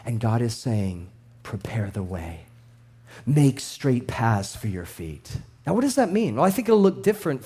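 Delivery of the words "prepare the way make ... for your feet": low, hushed and slow; hushed divine command, sunk low; voice of God. Acted. God's words voiced.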